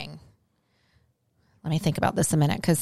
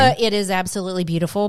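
usual speaking voice

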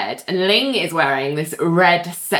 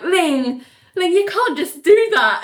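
high-pitched mock complaint; flustered protest; 10 semitones higher than usual. [name] objects to Ling rolling on him, flustered high protest. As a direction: protest in a higher, put-upon voice.

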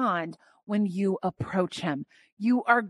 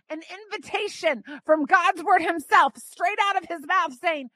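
ordinary talk, nothing marked

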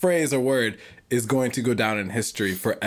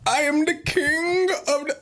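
high, pompous mock royalty; high mock king voice; 13 semitones higher than usual. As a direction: squeak out a royal proclamation. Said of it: "I wouldn't sound like that".

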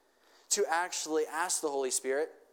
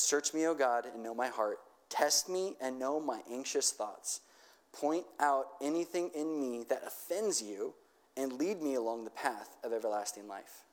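scripture reading; 3 semitones lower than usual. Acted. Reads psalm aloud.

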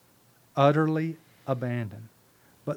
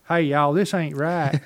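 put-on Southern accent; 2 semitones higher, 8 dB louder than usual. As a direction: play a Southern guardian angel protesting. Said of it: "my angel's got a real Southern accent".

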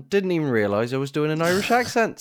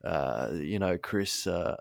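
low, slow Australian drawl in a lowered voice; deep Australian accent; 6 semitones lower, 7 dB quieter, slower than usual. Acted. Friend asks him for an Australian accent, he attempts a deep Australian voice. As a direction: try a deep Hemsworth-style Australian. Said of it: "That's my Australian".

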